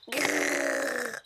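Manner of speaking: breathless pretend dinosaur growl. Little girl making her toy dinosaur growl.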